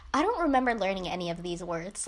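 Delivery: low, offhand retold remark; casual aside. Retelling his remark in the middle of a lecture, casual. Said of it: "he casually goes".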